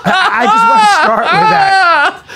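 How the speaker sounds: high, breaking with laughter; laughing. Real, not acted.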